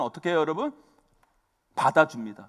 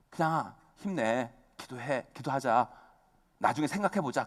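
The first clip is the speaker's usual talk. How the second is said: gentle comfort; 4 semitones lower, 7 dB quieter than usual. Retold or imitated. Wife shows the gentle reply.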